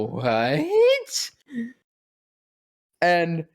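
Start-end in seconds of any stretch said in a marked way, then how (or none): 0.1-1.3 high, squeaky disbelief; jaw-drop squeak; stunned what. Retold or imitated. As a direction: gasp it out in shock. Stunned at meeting Kevin Conroy.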